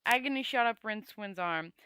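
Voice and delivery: plain reading voice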